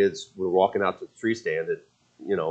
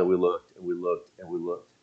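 each "and we looked" lower and softer; softer with every try; fading repetition; 4 semitones lower, 8 dB quieter than usual. Real, not acted. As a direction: let the search trail off into quiet. Repeated search, voice fading.

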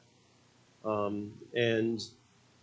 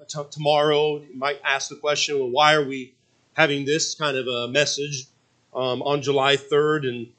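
louder preaching voice; 2 semitones higher, 6 dB louder than usual. Acted. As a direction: preach it to the congregation. Preaching at full force.

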